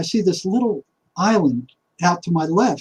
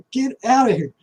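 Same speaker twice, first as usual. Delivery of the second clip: higher, laughing brush-off; laughing dismissal; 6 semitones higher than usual. Retold or imitated. Man shooing off his inner voice, amused and higher. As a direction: wave the voice away with a laugh, pitched up. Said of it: "And I'm like".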